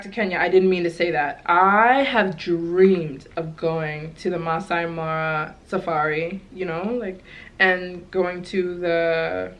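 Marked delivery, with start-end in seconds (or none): none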